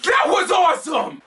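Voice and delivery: high, loud, thrilled shout; excited shout. Real, not acted. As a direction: burst out with delight. Excited after the special.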